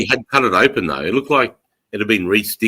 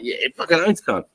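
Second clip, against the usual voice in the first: keen agreement; 7 semitones higher than usual. Retold or imitated. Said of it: "And I'm like".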